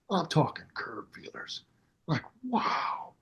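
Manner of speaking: very soft, whispery wonder; hushed awe. Retold or imitated. Storyteller recalling the tricked-out Cadillac, hushed awe. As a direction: drop right down to an awed whisper.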